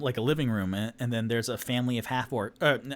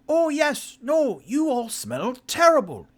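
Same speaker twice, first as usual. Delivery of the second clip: high halfling voice; 7 dB louder, slower than usual. Acted. Halfling agrees.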